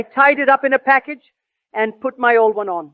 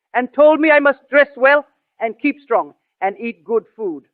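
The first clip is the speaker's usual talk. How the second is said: ordinary delivery, nothing marked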